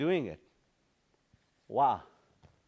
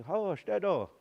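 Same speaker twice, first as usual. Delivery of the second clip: higher, elder's gentle "Oh, washte do"; grandpas' way; 8 semitones higher than usual. Retold or imitated. Teacher recalling how grandpas said it, a lightly voiced gentle elder. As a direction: say it like the old grandpas used to. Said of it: "just hearing our grandpas".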